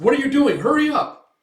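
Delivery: high, loud urgent scolding; impatient shout. Retold or imitated. Hooded man rushing Guts, loud impatient call. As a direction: rush them along. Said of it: "It's like".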